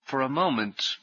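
ordinary delivery

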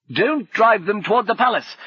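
high, loud battle call. Acted. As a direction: shout orders across the fight. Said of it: "called Brummond".

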